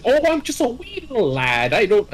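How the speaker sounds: high hammed-up Irish brogue; mock Irish accent. Imitating the Irish defendant's stage Irish accent. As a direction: play a cheery stage Irishman. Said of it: "clearly Irish".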